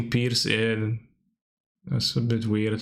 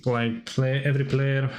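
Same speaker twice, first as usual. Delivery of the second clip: normal talking voice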